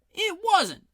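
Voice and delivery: very high, loud denial; second guard shouts back in a game fight; shouted. A narrator's reading. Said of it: "shouted a second voice".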